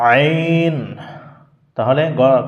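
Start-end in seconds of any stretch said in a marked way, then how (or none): 0.1-0.8 আইন pressed from the middle of the throat; throat letter ain. Shown as a demonstration. Teacher demonstrating to students the pressed আইন. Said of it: "try করি, কি হবে".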